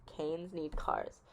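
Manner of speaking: slow it down and let it sink in; soft emphatic recap; slow, soft repeat. Shown as a demonstration. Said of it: "repeated slower".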